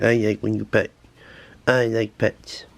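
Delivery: lightly put-on animal character; a hint of a cutesy pet voice; pet's imagined voice. Owner speaking for a friendly pet, light character voice. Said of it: "She's like".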